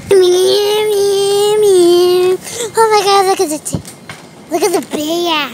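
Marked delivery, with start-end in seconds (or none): 0.1-2.4 loud, drawn-out cat meows; playful meowing. Pretend. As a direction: meow loudly and stretch each one. Makes noises while playing.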